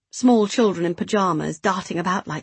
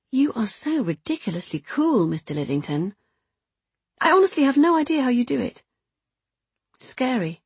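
lightly voiced woman, pitched higher; impressed secret lover; 6 semitones higher than usual. Woman to her lover on how he lies, mildly awed. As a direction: marvel at him, pitch jumping up.

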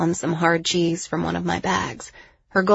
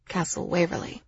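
ordinary delivery, nothing marked

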